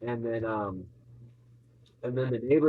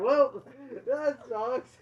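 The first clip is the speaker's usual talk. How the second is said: mock nonchalant shrug; 11 semitones higher, much slower than usual. Aunt shrugs it off.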